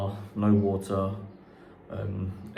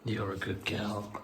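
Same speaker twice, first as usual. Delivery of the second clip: dog praise; 6 dB quieter, slower than usual. Real, not acted. Praising the dog after trick.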